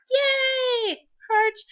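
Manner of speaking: very high excited squeal; squealed yay. Real, not acted. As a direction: squeal with glee at the heart. Reading fan message excitedly.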